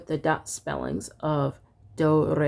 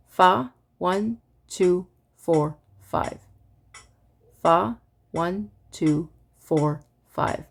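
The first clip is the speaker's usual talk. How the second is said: evenly spaced counting, a bit quicker; faster metronome recitation; slower than usual. Shown as a demonstration. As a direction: chant in time with a faster click. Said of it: "It would sound like this".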